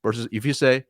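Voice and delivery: ordinary talk, nothing marked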